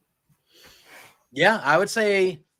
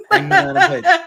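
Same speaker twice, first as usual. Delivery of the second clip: high, loud laughing name call; excited laughing announcement; 13 semitones higher, 9 dB louder than usual. Pretend. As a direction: announce him like a ring entrance. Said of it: "Everybody say it together".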